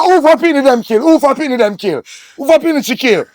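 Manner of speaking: high, loud repeated demand; frantic shout. Acted. Demanding who was killed, frantic shouting. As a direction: yell the question desperately.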